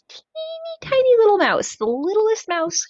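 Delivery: playful high squeak; tiny-mouse voice. Acted. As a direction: shrink your voice to the mouse's size. Describing a tiny mouse guide.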